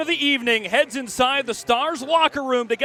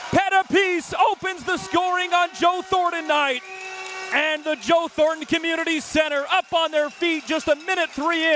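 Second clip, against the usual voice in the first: goal call; 5 semitones higher than usual. Acted. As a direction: let it explode on "he scores".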